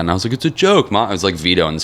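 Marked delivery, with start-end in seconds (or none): 0.3-1.1 high, whiny mock-kid voice; pleading protest. Kid to mom over stoner banner, pleading. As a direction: imitate a kid begging to be believed.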